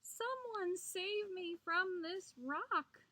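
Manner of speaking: high, slow wail for rescue; howling plea. A narrator's reading. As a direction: wail it out, drawn long. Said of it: "he howled".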